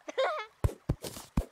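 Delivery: high squealing nervous giggle; laughing out the excitement. Real, not acted. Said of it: "I'm just freaking out".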